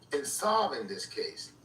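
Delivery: ordinary delivery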